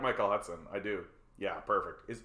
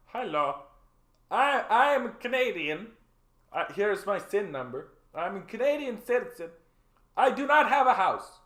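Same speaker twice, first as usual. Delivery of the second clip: high, polite plea to a clerk; earnest citizen at the counter; 7 semitones higher than usual. Retold or imitated. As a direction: play a humble citizen asking for a house. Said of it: "walk in the door and be like".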